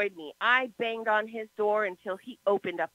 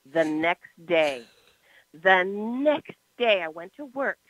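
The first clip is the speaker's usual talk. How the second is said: slow dramatic build; 4 semitones lower, slower than usual. Retold or imitated. Dramatic slowed retelling.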